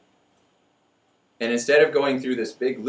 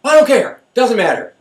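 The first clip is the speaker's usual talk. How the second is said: high, loud exasperated back-and-forth; mock quarrel; 12 semitones higher, 6 dB louder than usual. Mocking someone furious in Walmart instead of Target, high and exasperated. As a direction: play both sides of a silly argument.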